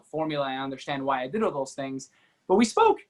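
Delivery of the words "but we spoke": excited emphasis. Real, not acted. Story builds to meeting.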